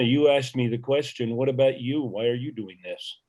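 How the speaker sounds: ordinary talk, nothing marked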